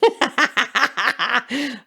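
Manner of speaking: real amused laugh; laughter. Real, not acted. Laughing at the dad remark.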